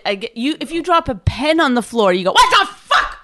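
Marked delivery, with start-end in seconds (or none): 2.3-3.2 mock outraged yell, very high; losing your mind. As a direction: imitate someone exploding over a trifle. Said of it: "You lose your mind".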